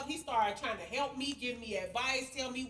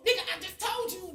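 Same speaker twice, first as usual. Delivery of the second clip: ordinary delivery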